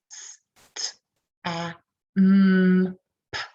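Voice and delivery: stamp 를 s, t, a, mm, p 로 하나씩 끊어서; 음소를 하나씩 분리해 발음; phonemes of stamp. Shown as a demonstration. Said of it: "think about the phonemes".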